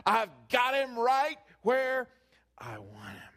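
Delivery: the devil, whisper building to a loud slow crowing; sly devil crowing over his catch; gloating devil. Retold or imitated. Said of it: "He's like".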